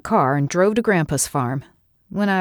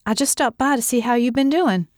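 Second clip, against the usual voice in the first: higher-pitched, careful cheer; mildly forced friendliness; trying to sound cheerful; 7 semitones higher than usual. A narrator's reading. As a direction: keep it civil and cheerful, pitched up. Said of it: "trying to sound cheerful".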